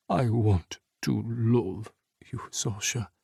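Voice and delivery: slow unvoiced whisper. A narrator's reading. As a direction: breathe the confession, barely there. Hesitant confession to Sasha.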